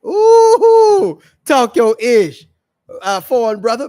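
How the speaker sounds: big high loud whoop, then slow emphatic praise; hyped cheer. Real, not acted. Host cheering on a guest's hot take, loud excited whoop. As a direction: cheer him on like a hype man. Hyping up the foreign brother.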